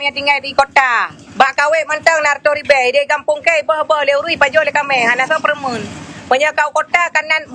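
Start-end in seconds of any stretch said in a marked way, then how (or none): none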